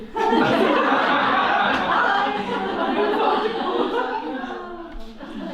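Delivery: long hearty laugh; laughter. Real, not acted. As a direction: crack up at length. Group laughs at acted exit.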